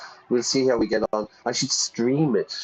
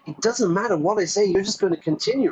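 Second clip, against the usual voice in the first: put-on high, fed-up Valley Girl; Valley Girl accent; 8 semitones higher, faster than usual. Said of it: "That's my best Valley Girl, uh, accent there".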